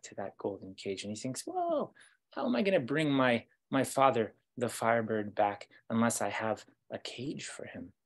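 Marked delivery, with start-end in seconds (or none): 1.5-7.9 excited wondering. Acted. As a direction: think aloud, struck by a bright idea. Prince marvels at the cage.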